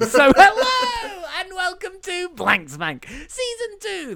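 high, drawn-out podcast opening; hammed-up show intro. Host greets the listeners of this show, hammed-up intro. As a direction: sing out the welcome in a stretched high voice.

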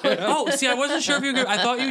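high, loud laughing talk. Real, not acted. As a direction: laugh while you tease. Teasing friend's anger.

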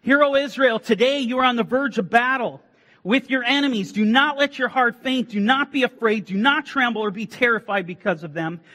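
loud, high proclamation of scripture. Acted. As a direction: proclaim it like a herald. Reciting scripture to congregation.

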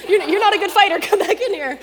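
laughing warning. Retold or imitated. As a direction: call him back while laughing. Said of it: "the guy's like".

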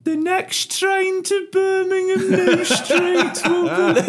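high, slow, loud Birmingham station announcement; sing-song mock tannoy voice breaking into laughter; intercom announcer. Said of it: "over the intercom goes".

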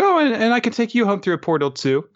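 put-on high, quick girlish character voice; Ruru. She offers a ride through the portal, high and quick. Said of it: "she's like".

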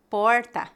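Porta with a curled English-like R; Portuguese: porta com R retroflexo; retroflex R. Shown as a demonstration. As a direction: curl the tongue back on the R of Porta. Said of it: "R retroflexo".

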